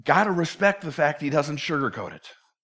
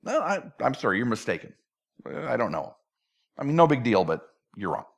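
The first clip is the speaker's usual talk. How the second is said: low-key, softer denial; simple denial; 5 semitones lower, 5 dB quieter than usual. Acted. Peter telling the servant girl she's mistaken, mild denial. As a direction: brush it off quietly, no big deal. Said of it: "just simple denial".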